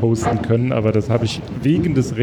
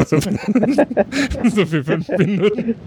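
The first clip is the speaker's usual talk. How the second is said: laughing; 7 semitones higher, much slower than usual. Real, not acted. Laughing about brief popularity.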